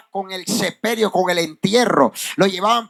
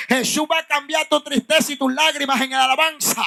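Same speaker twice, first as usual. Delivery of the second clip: higher-pitched, driving sermon climax; rousing preaching; 4 semitones higher than usual. Real, not acted. Preacher promises tears turned to praise, rousing high-pitched preaching. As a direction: build the congregation up to applause.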